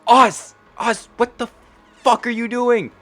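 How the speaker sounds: high-pitched, frantic scolding; exasperated yell. Acted. Lena to Oz as he nears the wolf, high exasperated yell. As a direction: call out in disbelief, voice shooting up.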